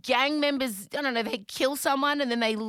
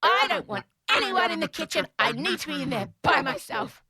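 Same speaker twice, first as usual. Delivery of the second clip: mild mock yell, higher; 7 semitones higher, 4 dB louder than usual. Mother calling out to the household, mild mock yell. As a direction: call out down the hall. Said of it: "and I yell out".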